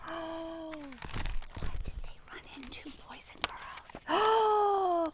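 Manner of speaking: put-on hushed, slow, breathy question to the kids; gasping storyteller whisper; suspense whisper. Shown as a demonstration. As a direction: draw the children in with a secret whisper. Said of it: "gasps, whisper to children".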